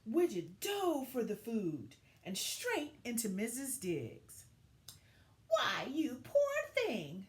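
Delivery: gentle, expressive picture-book narration; reading to children. A narrator's reading. Teacher reading a story to her class, storybook voice. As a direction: tell it like story time for young kids.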